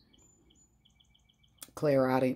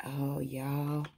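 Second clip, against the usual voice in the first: ordinary talk, nothing marked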